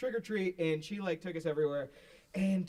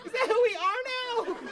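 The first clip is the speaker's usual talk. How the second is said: high, loud mock-indignant rant; laughing mock tirade at the coach; mock outrage; 11 semitones higher, 4 dB louder than usual. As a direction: tell the coach off with fake fury. Yelling at coach for reprimand.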